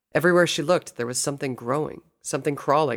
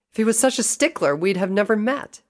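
high, loud, irritated retort; snapped; 9 semitones higher, 5 dB louder than usual. A narrator's reading. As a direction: snap back in a high voice. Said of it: "Rainbow snapped".

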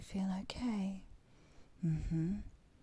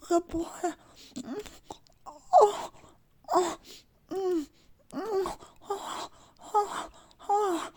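much higher, loud crying moans; moaning; 9 semitones higher, 8 dB louder than usual. Acted. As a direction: let the moans peak and crack. Climax moaning peaks.

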